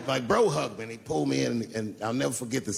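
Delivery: normal talking voice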